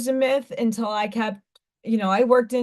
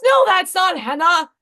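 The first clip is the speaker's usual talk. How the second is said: higher, louder stern mother; mother refusing the henna story, higher and louder; unconvinced mom; 8 semitones higher, 5 dB louder than usual. Retold or imitated. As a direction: mom shutting down the excuse, raised and firm. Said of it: "She goes".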